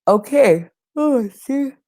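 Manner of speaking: talking through a yawn; words stretched by a yawn. Real, not acted. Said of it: "I'm yawning, you guys".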